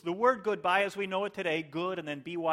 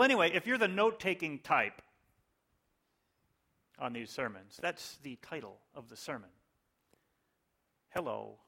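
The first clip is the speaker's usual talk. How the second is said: ordinary talk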